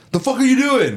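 high-pitched indignant challenge; mock angry guard. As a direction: imitate an outraged guard, pitch jumping up. Guard challenges him in story.

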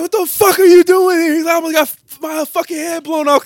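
very high, loud mock freak-out; freaked-out soldier. Retelling to friends, the shocked soldier yells at his buddy, very high, loud mock voice. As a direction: squeal it in hammed-up panic. Soldier yelling in retold scene.

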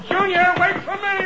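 high, frantic cry after the son; panicked call. Acted. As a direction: shout in a panic as you bolt. Panicked father runs after son.

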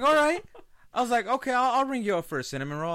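high, loud fake-sweet service voice; loud high sarcastic cheer; mock sweet cashier.

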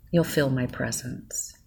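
ordinary talk

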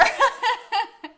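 high, loud burst of laughter; loud laughing. Real, not acted. She finishes mocking her friend, loud real laughter. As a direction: crack up at your own punchline.

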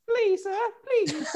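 high-pitched little begging voice; mock pleading. Friend joking about begging the cop, mock pleading. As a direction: beg the officer in a tiny put-on voice.

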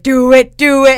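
loud, high mock crowd chant; egging on. Storyteller mimics everybody daring her on, loud egging on. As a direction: goad like a rowdy crowd.

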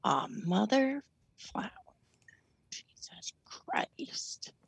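muttered curse under breath; whispered swearing. Real, not acted. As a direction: hiss it to yourself, frustrated.

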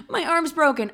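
high, hammed-up whine; milk the fake injury. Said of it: "This is my Oscar award-winning moment".

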